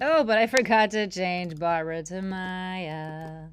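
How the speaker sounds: loud, slow oops, trailing out; mock dismay. Presenter noticing she forgot the name change, mock dismay. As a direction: draw out the forgotten detail in playful dismay.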